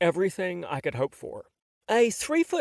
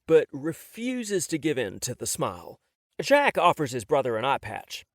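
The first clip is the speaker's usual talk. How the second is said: usual speaking voice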